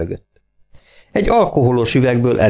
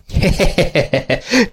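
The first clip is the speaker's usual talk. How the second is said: chuckle; 9 semitones higher than usual. A narrator's reading. Farmer laughing.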